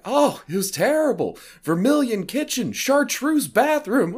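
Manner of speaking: high, loud, drawn-out mock horror of an old lady; hammed-up grandma aghast at the colours; scandalised grandmother.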